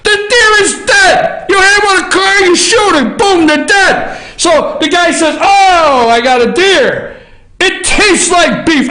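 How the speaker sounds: high, mocking hunter voice; hammed-up hunter. Host mocking a hunter's brag, high hammed-up voice. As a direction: play the dumb hunter high and silly.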